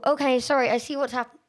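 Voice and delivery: ordinary talk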